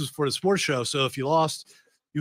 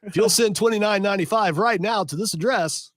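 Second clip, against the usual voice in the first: mock infomercial; 5 semitones higher, 10 dB louder than usual. Host riffs a fake Acme ad, booming pitchman voice. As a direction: sell it like a booming TV ad announcer.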